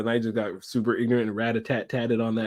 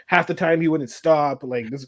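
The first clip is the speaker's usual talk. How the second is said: normal talking voice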